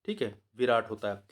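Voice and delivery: ordinary talk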